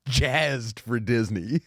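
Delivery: slow, stretched jazzed with a rise; giddy and drawn out; excited. Acted. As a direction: drag it out with glee.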